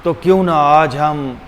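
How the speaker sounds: usual speaking voice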